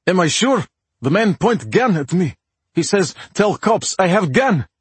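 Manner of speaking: put-on cab driver in thick Russian accent; urgent, broken English, heavy Russian accent. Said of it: "in a thick Russian accent".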